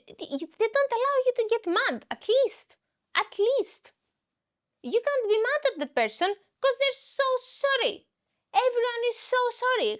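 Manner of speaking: frustrated rant, pitch up; exasperated, high. Real, not acted. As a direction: complain it's unfair, voice climbing.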